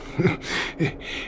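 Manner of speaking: quiet, breath-only little laugh; soft chuckle. Acted. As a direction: chuckle softly to reassure. Father reassures apologizing child.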